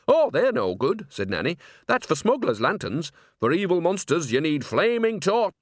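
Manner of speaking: put-on high, eager old witch lecturing; know-all old nanny. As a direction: explain it like a sure old gossip. Nanny lectures about torches.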